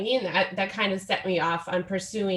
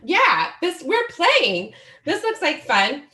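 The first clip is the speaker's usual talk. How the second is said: higher, bright voicing of her kids' glee; playful kid voice; 7 semitones higher, 4 dB louder than usual. Mom mimicking her kids who think lying on her is a game, high and playful. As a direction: chirp it like a delighted toddler. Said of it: "because they thought".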